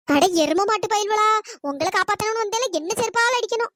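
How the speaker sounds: normal talking voice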